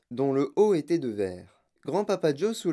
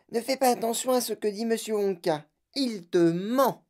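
high-pitched mother's character voice; madame Salt; 9 semitones higher than usual. A narrator's reading. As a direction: caution the child in a high voice, slowing at the end. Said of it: "dit madame Salt". (voix aiguë de mère qui met en garde)

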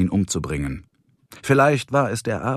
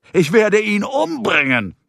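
high-pitched exasperated threat; incensed, voice shooting up; furious outburst; 11 semitones higher than usual. Acted. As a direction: explode at the end of your patience. Man explodes about the dog.

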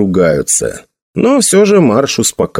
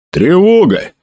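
short, higher urgent call; raised alarm; 6 semitones higher, slower than usual. A narrator's reading. Emil tells the captain of the alarm, urgently. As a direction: report the alarm briskly, without roaring. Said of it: "сказал Эмиль".

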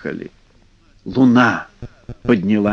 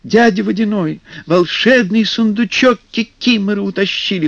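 urgent plea; 7 semitones higher than usual. Acted. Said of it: "хором ответили".

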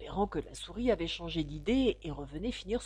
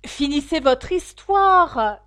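high, loud, drawn-out pleading shout; calling out; 11 semitones higher, 11 dB louder, slower than usual. A narrator's reading. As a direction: call after someone walking away, stretching the words. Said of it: "lui cria Alice". (cri implorant, aigu et traînant)

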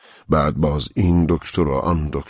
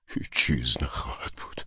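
hushed whisper; 2 semitones lower, 5 dB quieter than usual. Acted. Contemplating death alone.

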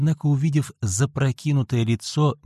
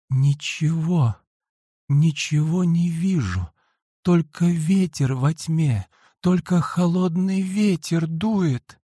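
fading dying voice; 6 semitones higher, slower than usual. A narrator's reading. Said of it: "продолжала твердить".

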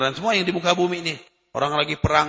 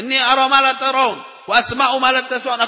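raised, measured Arabic recitation of a hadith; 7 semitones higher, 6 dB louder than usual. Acted. Preacher recites the hadith to the congregation, solemn and measured.

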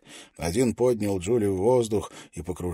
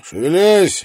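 loud guard bark; barking order; 10 semitones higher, 12 dB louder, slower than usual. A narrator's reading. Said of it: "рявкнул один из охранников". (рявкнуть, как охранник)

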